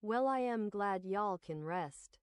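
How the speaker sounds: usual speaking voice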